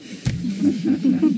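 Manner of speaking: bright high burst of laughter. Real, not acted. Laughing at the treasure-in-here joke, high laugh. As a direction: laugh at the punchline.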